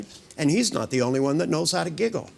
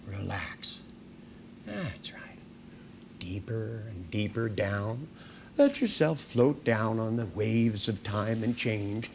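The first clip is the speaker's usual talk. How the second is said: hypnotic induction; 3 semitones lower than usual. Acted. Hypnotist deepening the trance.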